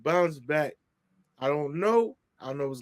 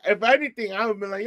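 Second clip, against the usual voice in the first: usual speaking voice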